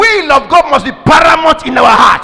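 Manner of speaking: fervent preaching. Real, not acted. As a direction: drive each line home to the congregation. Preaching at full force.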